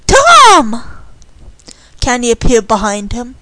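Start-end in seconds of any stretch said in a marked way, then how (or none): 0.1-0.8 loud, high call. Acted. Candy to Tom lassoing outside, calling out. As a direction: call over to a friend outside.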